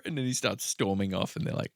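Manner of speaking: ordinary talk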